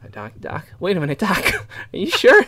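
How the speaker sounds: high, anxious, stammering movie-hero voice; high-pitched nervous impression; Michael J. Fox. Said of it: "it's Michael J. Fox".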